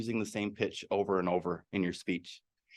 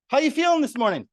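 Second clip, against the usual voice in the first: much higher and bright, bouncing hello; cheery greeting; 13 semitones higher, 5 dB louder, faster than usual. Acted. Cheerful coworker greeting the sour colleague, high and bouncy. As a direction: greet them like a ray of sunshine.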